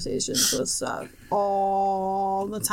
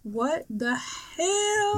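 drawn-out, higher retold complaint; exasperated disbelief; 4 semitones higher, much slower than usual. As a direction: stretch it out slowly in mock disbelief. Retold reaction to night yelling.

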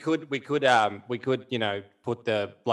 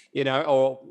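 usual speaking voice

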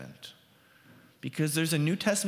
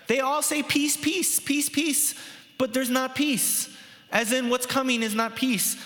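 mocking false prophets; 8 semitones higher than usual. Pastor mimicking false teachers for his congregation, high and mocking. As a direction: parrot the false prophets' empty promise, much higher. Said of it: "Jeremiah's accusation against the teachers of the time is like".

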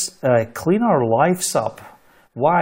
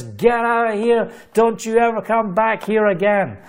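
retold angry scream; 6 semitones higher than usual. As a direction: re-enact her throwing you out. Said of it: "screaming at me".

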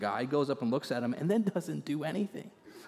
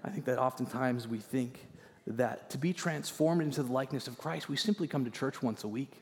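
ordinary delivery

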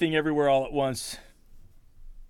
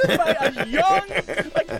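high, louder, laughing through it; 12 semitones higher, 4 dB louder, slower than usual. Real, not acted. Host laughing to his cohost about the tiny actress. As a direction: laugh while you marvel.

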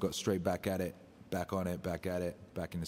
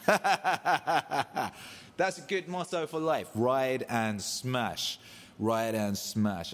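laughing; 4 semitones higher, much slower than usual. Real, not acted. Laughing at fan's motto.

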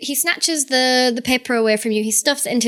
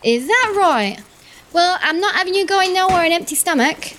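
cheery cook voice; 7 semitones higher than usual. Acted.

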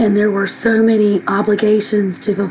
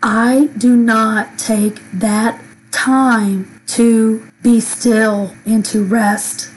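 ordinary talk, nothing marked

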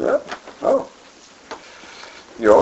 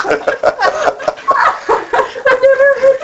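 high burst of laughter; laughing. Real, not acted. Laughing at the spoonerism.

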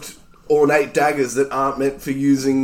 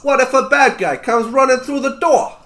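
high New York character voice; New Yorker accent; 9 semitones higher than usual. Acted. Knife-thrower justifies sleeping armed, New York accent. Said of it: "this is now my best accent".

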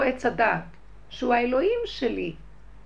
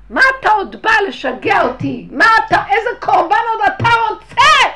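angry outburst; 10 semitones higher, 17 dB louder than usual. Retold or imitated.

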